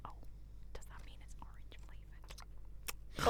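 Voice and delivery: breathy unvoiced whisper; hushed ASMR murmur. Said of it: "okay, too much".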